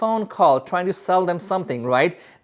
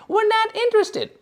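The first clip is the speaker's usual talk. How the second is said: much higher, put-on voice, dismissive; mock gatekeeper brush-off. Imitating a gatekeeper who brushes off a salesman, dismissive. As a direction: squeak out the brush-off like a bored gatekeeper. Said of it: "you don't want them to be like".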